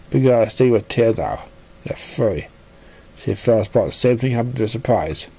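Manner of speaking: plain reading voice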